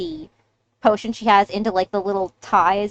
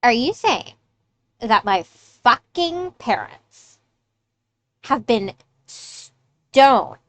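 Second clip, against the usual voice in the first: louder, incredulous outburst, drawn out word by word; outraged confrontation; 3 semitones higher, 6 dB louder, slower than usual. Acted. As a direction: stretch the curse words hard, then rush the last jab. Game character confronts the others, outraged.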